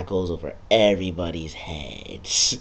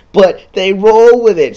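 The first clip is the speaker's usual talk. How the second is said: high, loud, slow mock announcement; mock exclaim; 11 semitones higher, 14 dB louder than usual. Gamer mocking the game's plot, in a high, loud mock shout. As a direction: belt it out like a sarcastic hype man.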